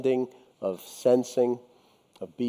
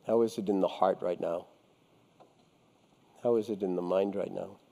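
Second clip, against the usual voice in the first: guided meditation; 3 semitones lower, 5 dB quieter than usual. Real, not acted. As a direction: guide the listeners gently inward, pitch easing down. Leads a short meditation.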